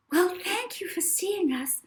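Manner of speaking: high, grateful mother; mother thanking the agent, high and eager; mother's voice. A narrator's reading. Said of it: "said the mother".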